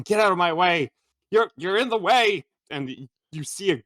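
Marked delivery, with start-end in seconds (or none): 0.0-2.5 higher-pitched irritated little gnome; small gnomish voice. Acted. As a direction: push past people as a cross gnome. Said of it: "gnomish voice going".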